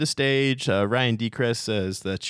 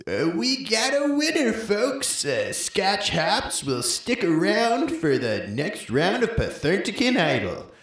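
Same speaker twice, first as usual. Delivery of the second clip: high, slowed, showy TV host call; host announcement; 9 semitones higher, slower than usual. Acted. Host announcing the winner to the crowd, showy and slow. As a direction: announce it like a game show host. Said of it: "and he yells".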